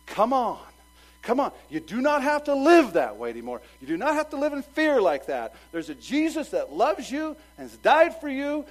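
loud, high pleading sermon call; full-force preaching. Acted. Preacher calling a lost soul to Jesus, loud and pleading. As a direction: plead with them to come, full voice.

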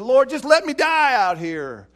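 loud, high anguished cry to the Lord; despairing plea. Acted. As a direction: wail it out like a broken man begging to die. Depressed Elijah cries to God.

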